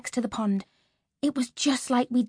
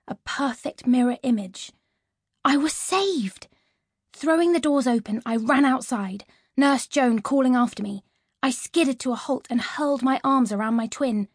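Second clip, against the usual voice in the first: ordinary delivery, nothing marked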